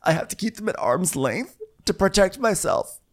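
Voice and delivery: high, halting mock-hurt voice; put-on wounded dignity, high-pitched; acted-out housewife. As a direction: declare it in high, broken phrases. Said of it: "And Kathy goes".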